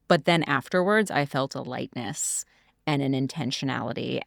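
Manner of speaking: usual speaking voice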